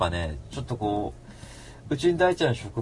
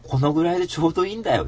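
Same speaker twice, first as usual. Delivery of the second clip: ordinary delivery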